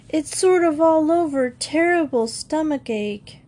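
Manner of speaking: whiny sick voice. Acted. Faking illness to mother.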